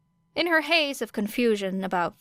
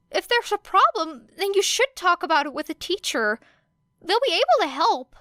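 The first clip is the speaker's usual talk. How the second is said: put-on higher, faster nervous boy voice, a little unsure; hesitant Midoriya; 10 semitones higher, 4 dB louder than usual. A narrator's reading. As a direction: rush it out, worried he's overstepping.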